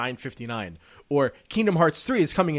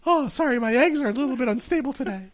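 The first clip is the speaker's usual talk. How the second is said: flirty high voice; 10 semitones higher than usual. Acted. Flirty creature bit continues.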